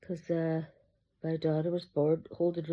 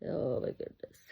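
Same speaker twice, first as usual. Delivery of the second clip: breathless whisper, lower and softer; awed whisper; 5 semitones lower, 8 dB quieter than usual. Real, not acted. Gushing over the baby doll.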